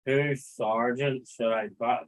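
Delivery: ordinary talk, nothing marked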